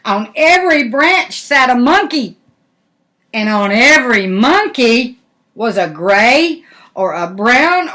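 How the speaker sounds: lively, bright picture-book read-aloud; animated storybook narration; reading to children. A narrator's reading. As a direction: tell it to little ones with wonder.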